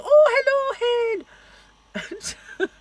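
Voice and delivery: high, very slow Scottish "hello, hen"; greeting a friend at work in a high Scottish accent. Retold or imitated. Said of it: "I'd go".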